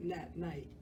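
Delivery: usual speaking voice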